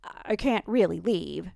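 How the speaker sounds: put-on slow, drunk, slurring words; slurred. A narrator's reading. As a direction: slur it like a drunk. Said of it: "slurred Ben".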